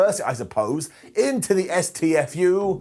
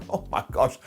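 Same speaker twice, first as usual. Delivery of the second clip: mock stunned whisper; whispered disbelief, acted out; 4 semitones lower than usual. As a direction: imitate fans too shocked to voice it. Acts out the stunned fans.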